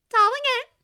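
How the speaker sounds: put-on high, bright girl's voice; bubbly young woman; cheerful young woman voice. A narrator's reading. Charlie voiced.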